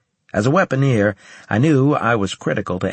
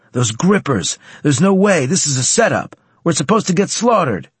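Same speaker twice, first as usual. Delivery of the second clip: high, loud panicked outburst; panicked shouting; 7 semitones higher, 4 dB louder than usual. Acted. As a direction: protest in alarm. Panicked trooper before hopeless fight.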